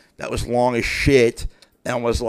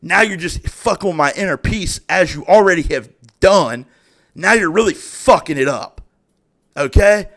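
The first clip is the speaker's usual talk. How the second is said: louder and louder, higher angry rant; getting mad; 5 semitones higher, 7 dB louder than usual. Real, not acted. Ranting about her flood of messages, rising anger. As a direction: build the anger line by line. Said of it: "I'm getting mad".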